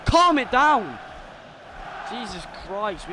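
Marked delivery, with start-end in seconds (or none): 0.1-1.0 raised, high-pitched plea; exasperated calling out; urgent shout. Real, not acted. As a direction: call out urgently to the team. Urging team to settle.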